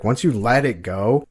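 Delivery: ordinary talk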